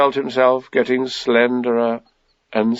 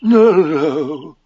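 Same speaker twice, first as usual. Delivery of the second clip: lightly careless, a little higher; 4 semitones higher than usual. A narrator's reading. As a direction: brush it off casually in a character voice. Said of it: "said Pooh carelessly".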